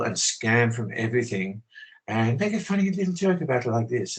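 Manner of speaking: put-on politically correct English politeness; mock polite English. Speaker mocking polite people who do evil, affected English politeness. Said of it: "They do this politically correct thing".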